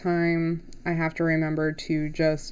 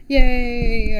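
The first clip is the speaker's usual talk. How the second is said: loud happy yay; real delighted whoop; excited cheer; 6 semitones higher, 12 dB louder than usual. Real, not acted. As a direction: cheer as it finally works. Tablet finally works.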